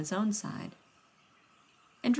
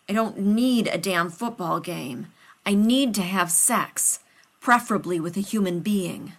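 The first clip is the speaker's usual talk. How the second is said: strained desperate; 2 semitones higher, 6 dB louder than usual. Acted. John desperate, strained plea.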